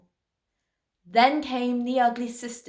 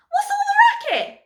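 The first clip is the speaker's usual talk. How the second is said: very high, loud squawk; shrieked question; 7 dB louder than usual. A narrator's reading. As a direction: cry out over the noise. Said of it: "Cindy cried".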